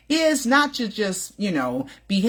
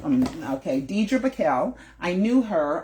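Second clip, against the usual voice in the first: usual speaking voice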